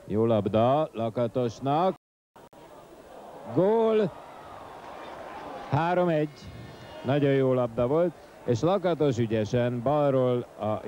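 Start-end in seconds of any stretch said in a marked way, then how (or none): 3.5-6.4 high goal shout; excited goal call. Real, not acted. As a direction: sing out the goal. Goal scored.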